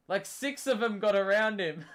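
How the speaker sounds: laughing while talking. Real, not acted. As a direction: laugh through the words. Laughing at bad defense.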